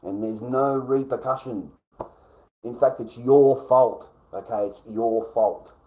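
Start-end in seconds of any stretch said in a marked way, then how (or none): 2.6-5.7 "your" leaned on hard, slow and loud; slow, pointed blame on "your"; stress on your. Real, not acted. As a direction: drive home "your fault", word by word. Blaming tone, slow emphatic repeat.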